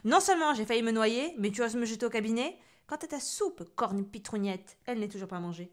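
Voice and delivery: loud, snarling witch rant; witch scolding. Acted. Witch Cornebidouille berating the boy, loud rant. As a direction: spit out the insults like a cranky witch.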